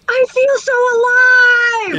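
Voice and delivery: retold excited yell. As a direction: yell it like a thrilled kid off a ride. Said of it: "just kept yelling".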